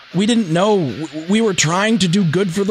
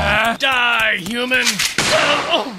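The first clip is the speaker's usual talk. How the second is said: zombie; 5 semitones higher, much slower than usual. Acted. Zombie attacks.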